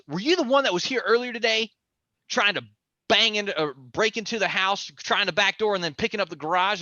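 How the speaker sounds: accusing. Retold or imitated. Angry neighbor accusing him of break-in, fast and high. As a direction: fire accusations quickly. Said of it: "he goes".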